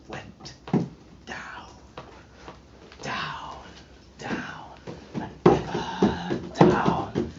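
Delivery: hushed, sinking whisper. Acted. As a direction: let each down sink into a whisper. Spirit sinking, voice fades away.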